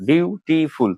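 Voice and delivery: বিউটিফুল in three slow beats; বিউটিফুল drawn out syllable by syllable; split into syllables. Shown as a demonstration. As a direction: separate বিউ, টি and ফুল in বিউটিফুল. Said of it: "তিনটা syllable আছে".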